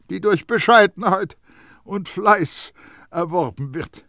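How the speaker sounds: put-on high, loud, lecturing old perfumer; Baldini, smug and let down. A narrator's reading. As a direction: gloat over the boy, then preach. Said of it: "gleichermaßen befriedigt wie enttäuscht".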